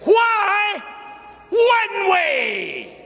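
very high, loud challenge; preacher leading the climactic response; shouted call-and-response. Real, not acted. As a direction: make them shout it back. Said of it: "Come on and say this with me".

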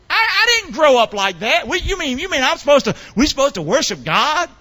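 high-pitched, stammering mock disbelief; incredulous protest. Parent caught out by the preacher, astonished.

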